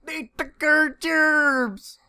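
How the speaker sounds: hillbilly voice. Retold or imitated. Meme quote.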